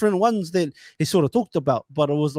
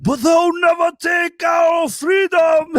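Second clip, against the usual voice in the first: hammed-up rallying war cry, much higher, louder and slow; mock battle shout; 13 semitones higher, 7 dB louder, much slower than usual. Joking with his friend, a hammed-up Braveheart battle shout. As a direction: bellow it like a movie warrior charging.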